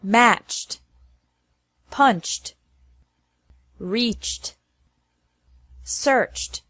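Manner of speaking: Matched, punched, searched ending in CH plus T; searched as one syllable closing on T. Shown as a demonstration. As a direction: say reached with ED as a T after CH. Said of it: "after verbs ending with a C H".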